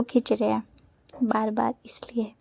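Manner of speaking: soft whispered aside to the camera. Real, not acted. Whispering an aside to viewers about the photos. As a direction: drop to a whisper for this bit.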